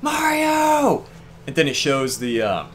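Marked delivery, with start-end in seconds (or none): 0.0-1.0 high shouted call of a name from afar; urgent high shout of Mario; offscreen shout. Retold or imitated. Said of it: "you hear someone go".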